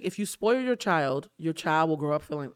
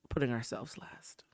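tearful whisper; 6 semitones lower, 11 dB quieter than usual. Real, not acted. Tearing up, holding back tears.

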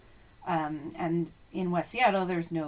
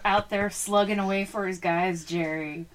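normal talking voice

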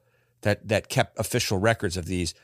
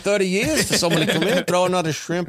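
high, fast Aussie caricature; mock Australian accent; 8 semitones higher, faster than usual. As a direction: rattle it off in broad fake Australian. Mock Australian version of quote.

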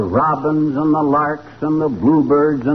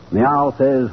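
ordinary delivery

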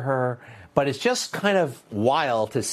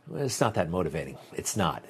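lower, softer, quicker letdown; deflated; 6 semitones lower, 6 dB quieter than usual. Real, not acted. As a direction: drop the hype and admit it. Deflates his own mock enthusiasm.

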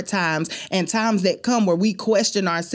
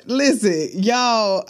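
ordinary delivery

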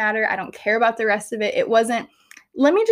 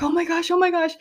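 higher breathless repeated gasp; overwhelmed by emotion, higher; 8 semitones higher than usual. Retold or imitated. Mother overwhelmed at first sight of baby, higher gasps. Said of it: "And I was like".

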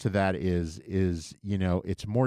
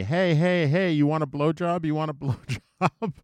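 mock shout; 7 semitones higher than usual. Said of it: "they start screaming at me".

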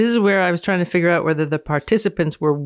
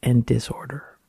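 whispered ending; 6 semitones lower, 4 dB quieter than usual. Acted. Dramatic end of read quote.

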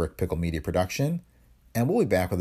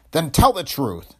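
loud, slow, higher-pitched order; angry demand; 9 semitones higher, 6 dB louder, slower than usual. Acted. Police chief to suspect, angrily demanding the truth. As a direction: demand it forcefully, word by word.

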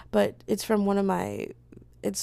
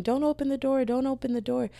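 retold scream; 7 semitones higher than usual. Dad warns his daughter from across the street, mock scream. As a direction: imitate a far-off yell without raising the volume. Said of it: "hear her dad screaming".

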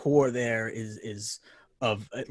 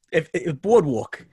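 Boardwalk drawn out slowly in a New York accent; 3 semitones higher, 5 dB louder, slower than usual. Shown as a demonstration. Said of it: "my New York accent".

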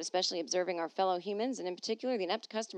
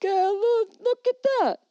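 put-on drunk guy voice; 4 semitones higher, 6 dB louder than usual. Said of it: "they're like".

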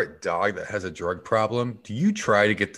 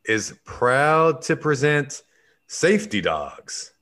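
show announcer; 4 semitones higher, slower than usual. Acted. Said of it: "announces a show".

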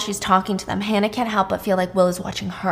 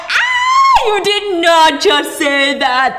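high, loud drawn-out hoot of mock shock; whooping groomsmen; 8 semitones higher, 6 dB louder, much slower than usual. Groomsmen razzing the couple at the party, high loud whoop. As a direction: hoot it out like rowdy frat boys egging each other on. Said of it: "They're all going".

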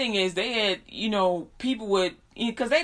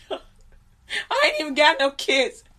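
high, slow, laugh-strained voice; helpless amused squeak; 6 semitones higher, 4 dB louder, slower than usual. Real, not acted. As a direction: say it while cracking up. Laughing at own story.

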